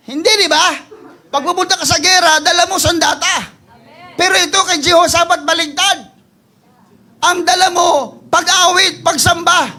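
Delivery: high, loud, driving preacher's voice; full-force preaching. Acted. Pastor preaches to the church, high loud and driving. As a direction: preach it hard to the congregation.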